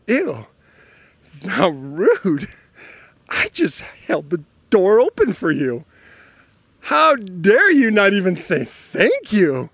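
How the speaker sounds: drawn-out, mock-outraged scolding; indignant. Offended door-holder silently scolding an ungrateful mom, indignant. Acting out offended inner thoughts.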